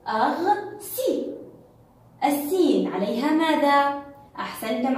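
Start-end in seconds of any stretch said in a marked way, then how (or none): 0.1-1.3 أغسل slow and higher, in pieces; split into syllables. Shown as a demonstration. Teacher breaking the word up for pupils, syllable by syllable. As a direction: stretch أغسل, pausing after the first syllable. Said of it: "هيا معا لنقطعها".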